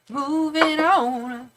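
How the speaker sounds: Moving on, each word long and lingering; sing-song drawl on Moving on; slow drawn-out. Shown as a demonstration. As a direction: stretch out Moving on very slowly. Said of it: "repeats it drawn out".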